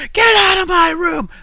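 loud, high shout; yelling family member. Retold or imitated. Imitating how his family yelled love at each other, loud shout. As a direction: bellow it like an angry parent at a kid's door. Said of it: "people talked like this".

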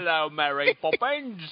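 slow exaggerated cockney; cockney accent. Shown as a demonstration.

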